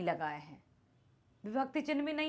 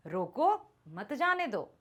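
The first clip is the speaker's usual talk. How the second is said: slow रोको, clear break, then मत जाने दो; pause after रोको; slower than usual. Shown as a demonstration. Teacher reading the punctuated sentence to students, stopping after रोको. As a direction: separate रोको from मत जाने दो, unhurried. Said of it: "अब हम इसको कैसे पढ़ेंगे".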